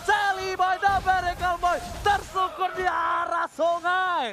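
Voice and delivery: high, loud play-by-play; esports hype call. Real, not acted. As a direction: call the fight with rising hype.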